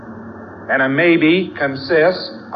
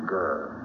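low, much softer growl; a quiet little growl at the dog; mock growl, lower and hushed; 9 semitones lower, 11 dB quieter than usual.

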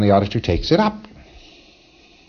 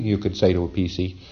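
ordinary talk

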